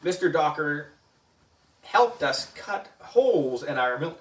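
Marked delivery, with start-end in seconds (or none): none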